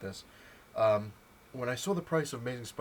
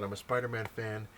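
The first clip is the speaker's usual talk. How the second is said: normal talking voice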